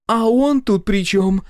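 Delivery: high, loud outburst; exploding. A narrator's reading. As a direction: blow up at him. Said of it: "взорвалась Машка".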